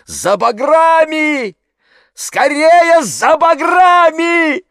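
high, drawn-out yell across a distance; urgent shout. A narrator's reading. Ipatov calls for boathooks at the pond, shouting. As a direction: call out desperately for help. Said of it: "слышался голос Ипатова".

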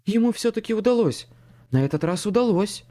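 a hint of an excited young tenor. A narrator's reading. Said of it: "совсем ещё молодой тенорок".

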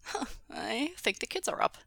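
light snorting aside; snorted. A narrator's reading. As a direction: huff it out with amusement. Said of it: "Wei Wuxian snorted".

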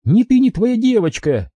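high, taunting female villain; mocking captor's voice; Maria's voice. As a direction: sneer it down at him.

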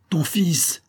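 high, falling cry of menace; repeated threat. A narrator's reading. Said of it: "répétait Escovedo". (menace répétée, voix haute qui retombe)

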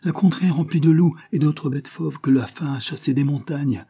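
lightly voiced old servant, pitched high; old coachman. A narrator's reading. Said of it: "continua le vieillard". (vieux cocher, voix légèrement haussée)

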